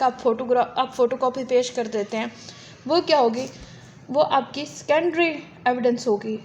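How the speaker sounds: normal talking voice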